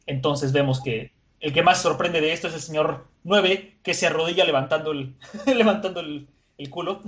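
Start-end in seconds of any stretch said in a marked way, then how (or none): 3.8-7.1 words broken by real laughter; laughing while telling. Real, not acted. Telling the friends about the kneeling, laughing. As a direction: giggle through the description.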